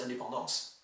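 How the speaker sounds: French 'indépendance', all syllables evenly heard; even-syllabled French 'indépendance'; French pronunciation. Shown as a demonstration. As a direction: say 'indépendance' in French with nasal vowels. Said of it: "you hear all the syllables".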